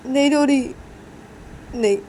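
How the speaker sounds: lightly acted teary voice; tearful denial. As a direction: insist through mock tears. Wiping tears, denies crying.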